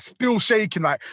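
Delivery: usual speaking voice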